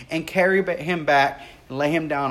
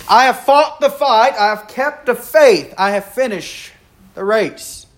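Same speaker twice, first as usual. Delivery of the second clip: loud and high, ringing out; triumphant proclamation; 5 semitones higher, 6 dB louder than usual. Acted. Preacher declaring the verse to the congregation, loud and triumphant. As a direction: proclaim it like a victory cry.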